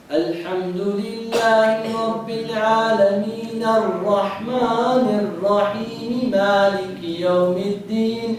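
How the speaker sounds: verses joined in one flowing run; joined reading. Shown as a demonstration. Teacher showing how to join the verses when reading fast. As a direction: link the verses without stopping. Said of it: "if you're reading fast".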